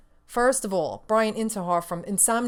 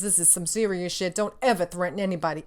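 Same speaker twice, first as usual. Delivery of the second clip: normal talking voice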